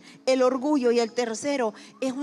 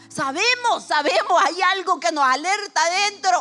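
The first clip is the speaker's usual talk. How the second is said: louder, pressing each phrase home; preaching emphasis; 3 semitones higher, 7 dB louder than usual. Acted. Preacher to the congregation, insistent louder preaching. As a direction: hammer the point to the congregation.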